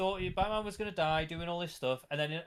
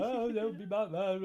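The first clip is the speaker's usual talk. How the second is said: hammed-up tearful begging; mock crying Alfred; 2 semitones higher than usual. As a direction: fake weepy plea. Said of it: "he's just there crying all the time".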